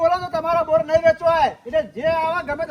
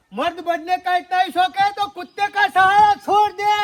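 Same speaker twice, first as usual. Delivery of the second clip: louder filmy Hindi dialogue delivery; Hindi film dialogue; 4 dB louder than usual. Acted. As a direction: hurl it like a Bollywood villain line. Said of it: "Hindi બોલવા માંડે". (હિન્દી ફિલ્મી dialog જેવો અવાજ)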